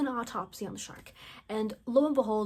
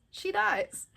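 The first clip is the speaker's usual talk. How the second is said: high, stunned emphasis; can't believe it; shocked repeat; 10 semitones higher than usual. Retold or imitated.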